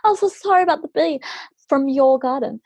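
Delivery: high, fast, fussing apology; overly polite apology. Retold or imitated. Mum apologising to the host for his garden bee. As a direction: gush your apology politely. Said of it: "she's still trying to be".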